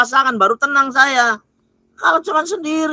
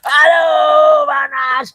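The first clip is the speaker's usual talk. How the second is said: screaming in pain; 2 semitones higher, much slower than usual. Acted. Amulet touched again, screaming.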